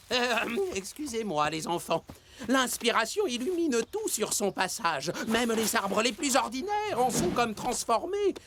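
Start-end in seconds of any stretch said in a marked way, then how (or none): none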